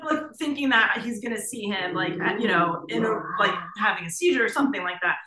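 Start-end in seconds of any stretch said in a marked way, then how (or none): none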